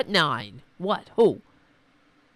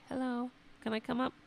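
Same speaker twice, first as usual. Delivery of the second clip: voicing the pet; 6 semitones higher, 9 dB quieter, faster than usual. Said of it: "He's like".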